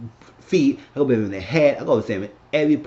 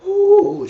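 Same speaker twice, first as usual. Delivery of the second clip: big gasp, then high loud "ooh"; imitated crowd gasp; 9 dB louder than usual. Everybody reacting to the insult, mock shock.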